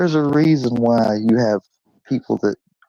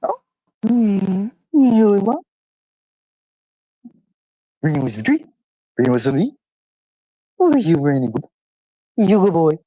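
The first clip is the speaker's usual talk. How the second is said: talking to his dog; 9 semitones higher, 3 dB louder than usual. Real, not acted. Owner praising his dog Brownie, high baby talk.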